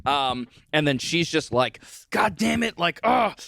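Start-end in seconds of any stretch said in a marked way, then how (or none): 2.1-3.4 high-pitched exasperated outburst; higher, fed-up groaning quote; mock frustration. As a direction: act out her exasperation. Acting out her frustration.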